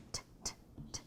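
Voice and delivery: t, t, t as soft voiceless clicks; voiceless t taps. Shown as a demonstration. Teacher showing the t sound to students. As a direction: tap the t with no voice. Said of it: "teacher sounds final t".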